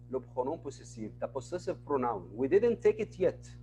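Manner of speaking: usual speaking voice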